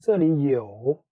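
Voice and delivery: 这里有 with 有 stretched long and clear; slow, drawn-out 这里有 for the learner. Shown as a demonstration. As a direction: draw out 这里有 slowly to model it. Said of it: "you can say very long, clear".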